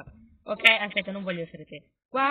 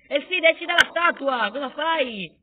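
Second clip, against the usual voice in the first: loud, high scolding of a useless ally; exasperated yelling; 5 semitones higher, 7 dB louder than usual. Pretend. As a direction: call out in frantic exasperation. Yells at his useless hero.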